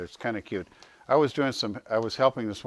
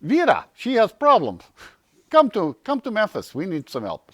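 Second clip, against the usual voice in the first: high, loud imitation of the Russian coach in broken English; Russian coach's accent, voiced high; 11 semitones higher, 7 dB louder than usual. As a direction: mimic the Russian coach asking for help. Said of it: "that's how she talked".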